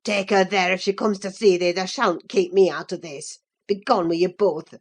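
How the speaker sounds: deep, furious man's voice. A narrator's reading. Furious cousin driving the pair out of the room. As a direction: order them away in a low, angry voice. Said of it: "his face looked swelled and furious".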